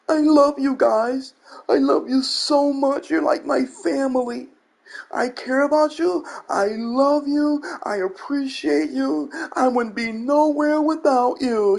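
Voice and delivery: high, slow, syrupy fake-loving streamer voice; mock the gushing streamer outro; mocking YouTuber. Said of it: "What do they always say?".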